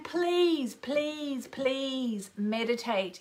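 drawn-out, separate pleases; earnest, lingering stress on "please"; pleading emphasis. Real, not acted. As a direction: plead with them, stretching each "please". Urging listeners to meditate.

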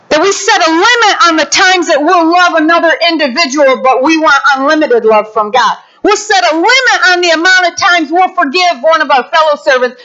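ordinary talk

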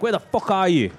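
put-on Scottish accent. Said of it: "he had a real Scottish accent".

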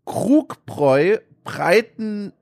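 slowly spelling out the name; slow careful reading. Real, not acted. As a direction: read the label slowly, word by word. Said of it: "jetzt muss ich mich konzentrieren".

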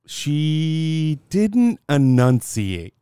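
drawn-out mock scolding; slow teasing. Real, not acted. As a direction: stretch each word, teasing.

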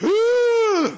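sharp high gasp of panic; shocked gasp. Retold or imitated. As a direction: gasp as if struck by lightning. Sudden panic about lost computer.

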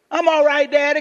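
put-on high, loud small-child voice; little daughter. Daughter on the beach ball answering Daddy, little-girl voice. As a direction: imitate a child insisting to Daddy. Five-year-old daughter answering her father.

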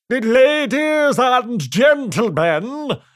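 slow, loud royal proclamation; pompous king. Acted. The king addressing the crowd, slow and pompous. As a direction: boom it out grandly and slowly. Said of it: "he said to the crowd".

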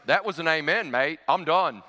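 punchy Aussie matter-of-factness; put-on Australian accent, briskly final; brash Australian. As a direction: imitate a blunt Australian bloke. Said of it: "known for a very brash personality".

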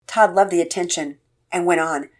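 usual speaking voice

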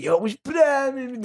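high, friendly greeting in imitation; mock player greeting. Player answering the fan, high imitated greeting. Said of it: "He was like".

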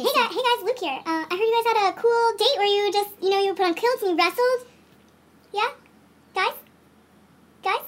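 high, soft, tentative greeting; hesitant outsider. Acted. Luke trying to join the other suitors, tentative and unanswered. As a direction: fish timidly for a response.

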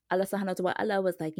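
ordinary delivery

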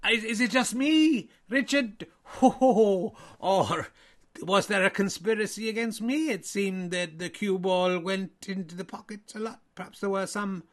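a hammed-up Irish voice that slips into Welsh; failed Irish accent. Irish me complaining to Richard, in a mock Irish that sounds Welsh. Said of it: "You're extremely Welsh".

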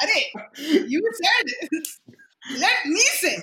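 high-pitched, laughing imitation of butting in; playful retold protest through laughter; laughing mock interruption. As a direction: act out cutting in while cracking up. Acting out eager interruptions, laughing.

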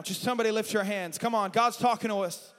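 ordinary talk

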